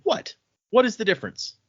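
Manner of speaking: high, baffled disbelief; incredulous. Retold or imitated. Kaladin pushing back at Syl, high incredulous retort. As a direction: pitch up sharply in mock disbelief.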